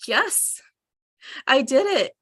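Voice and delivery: ordinary talk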